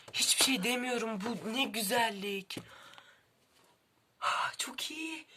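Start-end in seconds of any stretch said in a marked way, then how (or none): 4.2-5.3 whisper. Real, not acted. Admiring a book's binding.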